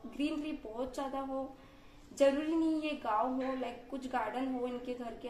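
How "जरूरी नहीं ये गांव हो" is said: normal talking voice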